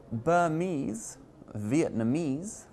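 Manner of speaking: "Burmese" and "Vietnamese", each stressed on the final ESE; stress on final syllable. Shown as a demonstration. Teacher demonstrating suffix stress, "Burmese" and "Vietnamese" stressed on the last syllable. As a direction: put the weight on the endings of "Burmese" and "Vietnamese". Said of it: "which you generally stress".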